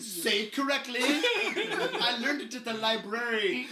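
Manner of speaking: high, slow, laughing pedant; know-it-all voice. Retold or imitated. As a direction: lecture like a smug bookworm. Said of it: "He would say".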